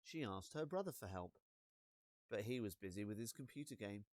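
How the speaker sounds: ordinary delivery, nothing marked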